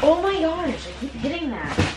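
strong Minnesota accent, high exclaim; broad Upper Midwest accent. Real, not acted. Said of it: "Really went with the deep Minnesota accent right there".

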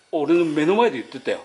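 ordinary talk, nothing marked

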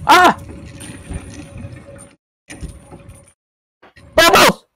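loud, high yells while fleeing; frightened screams. Real, not acted. Player runs from the monster screaming. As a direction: scream and urge on in panic.

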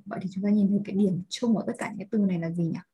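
ordinary delivery

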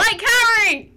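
loud, gleeful jab; teasing yell. Real, not acted. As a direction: shout the tease. Teasing friend loudly.

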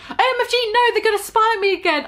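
high, fast panicked shriek; panicked cry. Acted. Heroine panicking in the changing room, high mock shriek. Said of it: "I cried".

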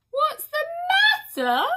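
very high, loud wailing repeat with a rise; wailing echo. A narrator's reading. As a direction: howl it back in grief. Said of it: "repeated the second one".

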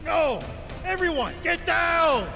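high, drawn-out cry of alarm; alarmed shout. Acted. Warning everyone to take cover, alarmed shout.

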